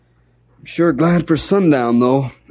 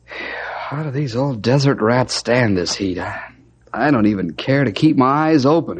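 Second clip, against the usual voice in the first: ordinary delivery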